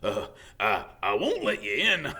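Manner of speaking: out of breath; puffing between words. A narrator's reading. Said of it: "he puffed".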